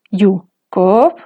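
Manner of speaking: yu low then koob rising; tone comparison. Shown as a demonstration. Teacher comparing low tone with koob, careful. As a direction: set yu beside koob to hear the difference. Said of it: "Tendrá el mismo tono de tierra".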